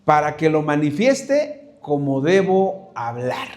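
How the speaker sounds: "Para que lo manifieste como debo hablar" said slowly, word by word; slow recitation. Shown as a demonstration. Preacher leading congregation in slow recitation. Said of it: "Repita conmigo".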